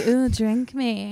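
slow, drawn-out seductive line; sexy voice. Retold or imitated. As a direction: stretch it out slowly like a come-on. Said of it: "be a sexy shoe".

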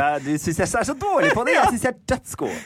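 high, laughing Nordland dialect attempt; playful Nordland lilt, climbing very high. Shown as a demonstration. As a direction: show off a put-on Nordland dialect. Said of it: "Jeg vil ha nordlandsdialekt".

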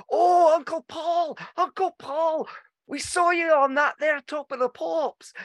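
high childish voice, imitating kids; squeaky kid voice, proud and eager; wee cousins. Uncle recalls his wee cousins greeting him, high child voice. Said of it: "all the wee cousins are there and went".

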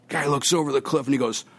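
ordinary talk, nothing marked

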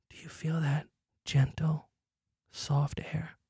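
slow, hushed mock-sensual whisper; gentle whisper. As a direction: whisper it slowly like a soft breeze. Mock sensual evocation of fall breeze.

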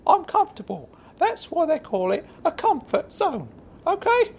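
very high-pitched character voice, indignant; Claude. Acted. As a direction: argue back in a high, squeaky little voice. Plays Claude, arguing with Frank.

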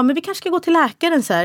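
lightly voiced fussing family suggesting the doctor; mildly worried in-laws. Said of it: "Ja, och så de bara". (lätt ängslig, ljusare röst)

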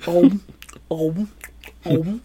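mock "om" chomping sounds; munching noises. Patrick eating thrown peanuts, imitated munching. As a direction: gobble in spaced cartoon bites.

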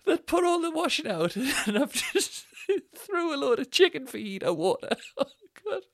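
high squeal of real laughter; helpless laughter. Real, not acted. Comedian recalling the fajita water, real helpless laughter. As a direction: laugh right through the words, voice up high. Cracking up retelling the disaster.

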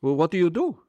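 put-on high, incredulous foreign-accented grilling; a big Turkish owner quizzing the drunk student; Turkish kebab shop owner. Shop owner grills drunk student, high incredulous accented voice. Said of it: "He says".

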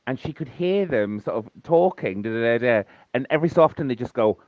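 usual speaking voice

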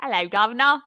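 jokey British greeting; hammed-up British accent. Shown as a demonstration. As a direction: put on a mock British accent. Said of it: "Can you do a British accent?".

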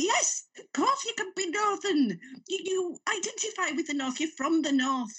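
high-pitched, eager reassurance; reassuring fan. Retold or imitated. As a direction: brightly insist they belong. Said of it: "And I'd be like".

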